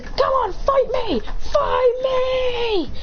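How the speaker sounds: high-pitched yell daring a fight; shouted challenge. Acted. Fighter daring his opponent, high shouted challenge. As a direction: cry out the challenge, stretching the last word.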